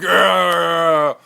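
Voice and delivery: loud high meathead yell; bro cheer. Acted. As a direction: whoop it like a frat guy. Bros cheer.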